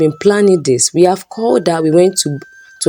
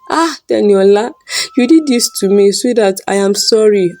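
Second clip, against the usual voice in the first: higher, pleading wail; despairing wail; 4 semitones higher than usual. A narrator's reading. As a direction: cry out in betrayal. Husband blames caught girl.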